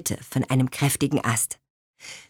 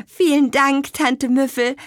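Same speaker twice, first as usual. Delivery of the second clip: high, hurried bright thank-you, louder; quick thanks; 8 semitones higher, 4 dB louder than usual. A narrator's reading. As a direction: rush out the thanks. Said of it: "sagte Petronella schnell".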